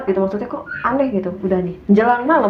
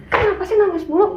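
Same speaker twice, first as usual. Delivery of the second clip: annoyed snap; 9 semitones higher than usual. Retold or imitated. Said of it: "saking keselnya gue ngedobrak kaki".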